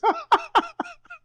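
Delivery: very high squealing laugh; shrieking laugh. Real, not acted. Host cracking up at caller, high shrieking laugh. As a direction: cackle up into a shriek.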